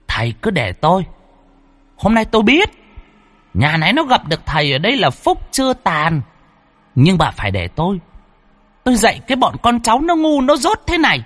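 high-pitched scolding elder, rising to shrill; domineering ancestor spirit. A narrator's reading. Ancestral spirit lecturing foolish descendants, high and imperious. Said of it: "ăn nói cư xử cứ như là bề trên".

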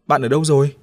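loud searching call. Acted. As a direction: shout into the crowd. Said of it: "cất tiếng kêu vang". (kêu to tìm bạn)

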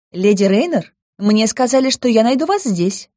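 high bright little nymph voice; pixie. Acted. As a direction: chirp it, bubbly and eager.

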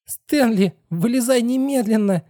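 very high-pitched tense command; changed tone. A narrator's reading. Girl suddenly uneasy about the boy, changed tense tone. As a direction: order it urgently, pitched right up. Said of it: "произнесла Китти совершенно другим тоном".

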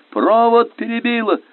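high, drawn-out yell in battle; shouted cry. A narrator's reading. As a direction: yell it high across the boat. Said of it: "крикнул он".

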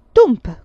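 loud, high imitated thump; explosive banging noise; bench thump. A narrator's reading. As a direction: punch out the bang of wood.

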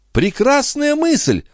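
high, drawn-out delight; excited cry. A narrator's reading. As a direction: cry out at a brilliant idea. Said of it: "вскричала графиня".

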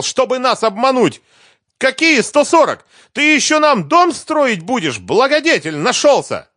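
high, loud, furious outburst; furious tirade. A narrator's reading. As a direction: let the rage pour out at him. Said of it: "начала заводиться Лиза".

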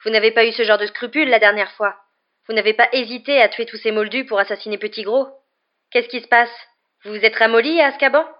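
heated, scornful challenge; angry taunt. A narrator's reading. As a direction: goad the enemy with angry questions. Harry taunts his parents' killer.

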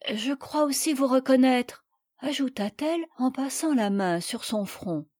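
plain reading voice

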